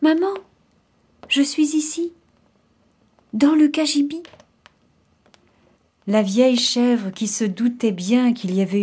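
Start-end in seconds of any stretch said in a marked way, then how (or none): 0.0-4.2 put-on high, small kid voice; little voice. Surviving kid calls mother from the closet, little high voice. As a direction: answer as a tiny young goat. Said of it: "Une petite voix lui répondit".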